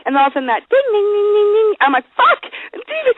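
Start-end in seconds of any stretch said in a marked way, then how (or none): 0.7-1.7 high-pitched ringing dings; rapid high imitation of eerie notes; imitated music chime. 2.1-3.1 very high panicked yelp; hammed-up high-pitched scare; mock terror. Retold reaction to scary music.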